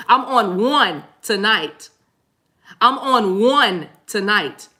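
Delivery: very slow, each word stretched for emphasis; slow, drawn out. Real, not acted. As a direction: spell it out slowly for the back row. Repeats line ever slower for effect.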